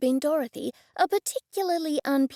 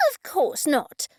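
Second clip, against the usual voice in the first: snappily; 4 semitones higher, slower than usual. A narrator's reading. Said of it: "Cressida said snappily".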